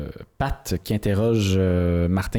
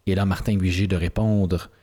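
normal talking voice

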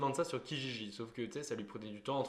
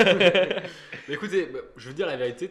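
loud laughter through words; laughing; 4 semitones higher, 13 dB louder than usual. Real, not acted. Laughing at host's reproach. (en riant)

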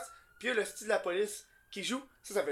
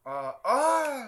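raised, louder "ah" of discovery; sudden realization; 6 semitones higher, 5 dB louder than usual. Real, not acted. Host finally gets the guest's name, surprised realization. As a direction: let the penny drop, high and loud.

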